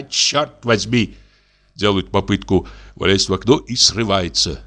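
ordinary delivery

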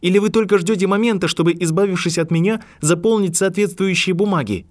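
high, loud, fast accusation; seething fury. A narrator's reading. Harry still furious at McGonagall, fast loud. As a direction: spit the accusation out quickly. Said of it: "Ярость всё ещё бурлила в крови".

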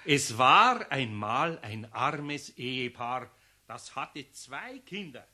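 slow fairy-tale opening; slow storyteller voice fading out; storytelling. Acted. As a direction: begin the fairy tale solemnly, slowly. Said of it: "jetzt fang ich an".

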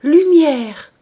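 high, ringing shout of revelation; cried out. A narrator's reading. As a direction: shout it out, suddenly alive. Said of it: "cria-t-elle".

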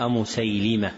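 أمسيلمة with an "i" after the lam, "Musaylima"; kasra on lam. Shown as a demonstration. Teacher citing a misprint, أمسيلمة with kasra on the lam. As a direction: say أمسيلمة as "-lima". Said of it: "بكسر اللام".

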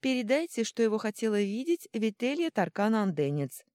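high, put-on female voice; Vita's voice imitated. A narrator's reading. Little god answering the butler as Vita, mimicking her. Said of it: "ответил Кипиш Витиным голосом".

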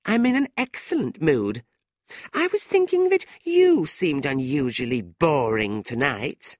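high, catty comeback; airy retort. A narrator's reading. Tilda throwing the insult back at Fanny, catty. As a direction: start high, then turn the jibe back.

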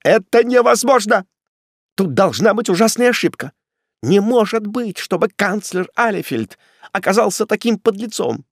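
high, agitated pleading; desperate protest. A narrator's reading. Condemned man to executioner, desperate high-pitched protest. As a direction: protest frantically for your life. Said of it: "вскричал Муздаман, поднимаясь с полу".

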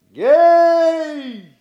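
high, loud, drawn-out holler; shouted call. A narrator's reading. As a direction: shout it out for an echo. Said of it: "Крикнешь ему". (громкий протяжный окрик)

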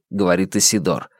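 plain reading voice

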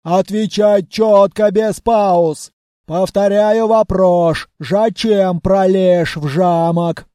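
slow, heavy, loud orc voice; menacing orc grilling a prisoner; gruff orc interrogator. A narrator's reading. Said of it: "допрашивал пленного в своей неповторимой манере".

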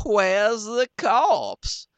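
high, loud, drawn-out cry; crowd calling out. Acted. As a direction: call it out slow and shrill with alarm. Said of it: "they all sings out".

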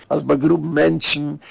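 normal talking voice

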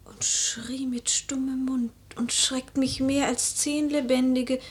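whispered, as if to herself; haunted whisper. Acted. Lady at her lover's grave, whispering to herself. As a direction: barely voice it, lost in dread.

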